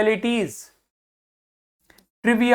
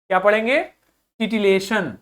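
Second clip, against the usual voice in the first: ordinary delivery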